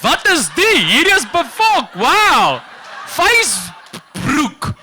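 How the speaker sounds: high, loud mock Afrikaans amazement; exaggerated Afrikaans accent. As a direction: gush in a thick Afrikaans accent over the internet. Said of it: "We're like".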